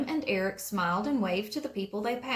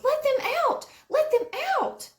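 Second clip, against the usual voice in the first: high, loud frantic yell; Aunt Molly yelling through the windshield; 6 dB louder than usual. A narrator's reading. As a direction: shriek it at the police car. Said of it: "she yelled".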